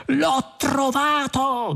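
high, drawn-out cry of discovery; triumphant cry. A narrator's reading. Zaphod announcing his find on the bridge, crying out. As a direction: cry it out slowly in high-pitched triumph, without extra volume. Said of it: "gridò Zaphod".

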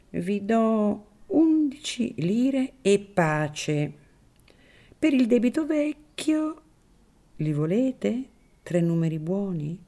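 put-on a wary old priest keeping his distance; Don Cirillo, wary priest.

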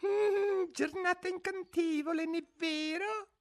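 very high, tiny rabbit voice; timid little voice. A narrator's reading. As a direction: squeak it shyly like a nervous rabbit. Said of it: "disse timidamente una vocina".

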